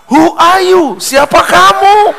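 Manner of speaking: high, loud panicky blurt; he panics and yells the wrong question; flustered shout. Acted. Nervous husband blurts it at the foreign guest, high and loud.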